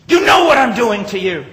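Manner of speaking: high, loud imitated taunt; angry retold shout. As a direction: yell it as the provoking policeman. Enraged policeman taunting him, retold.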